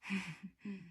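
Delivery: quiet laugh from the darkness; sinister soft laugh. A narrator's reading. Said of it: "the voice laughed in the darkness".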